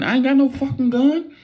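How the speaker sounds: high-pitched alarmed denial; panicked. Retold or imitated. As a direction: squeal it in a panic.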